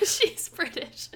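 slow, high words through laughter; laughing. Real, not acted. Co-hosts joking about the miscast British actress, laughing. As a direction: crack up while saying it.

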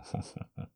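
quiet amused chuckle; a low satisfied laugh; hushed breathy laugh; soft laugh. Real, not acted. Laughs after partner came.

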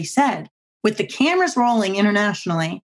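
ordinary talk

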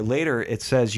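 ordinary delivery

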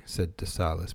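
plain reading voice

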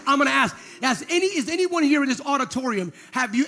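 ordinary delivery

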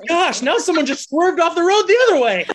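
loud laughing exclamation; high, loud, laughing outburst. Real, not acted. Laughing about listeners crashing.

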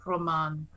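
"Roman" low and soft, ending in a long "mahn"; imitated pronunciation. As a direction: savour "Roman" quietly, stretching the last vowel. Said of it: "I love the way they pronounce it".

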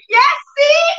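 very high, loud jubilant shout; triumphant. Retold or imitated. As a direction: cry out in retold triumph. Acting out triumph at healing.

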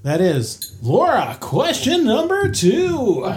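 big, drawn-out announcer voice; game show host. Pretend. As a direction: stretch it like a TV quiz host. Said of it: "I'm talking like a game show host".

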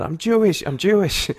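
mock yell. Clarifying the earlier callback gag for listeners, high mock yell. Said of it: "when you yelled out".

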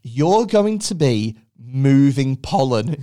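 slow, low, deliberate announcement; job centre adviser. Acted. Adviser revealing the pollen job, slow and deliberate. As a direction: solemnly announce an absurd job. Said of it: "they were like".

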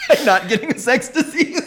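high, breaking up with laughter; laughing. Real, not acted. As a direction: crack up while saying it. Joking punchline breaking into laughter.

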